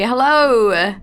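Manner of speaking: ordinary delivery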